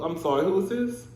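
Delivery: usual speaking voice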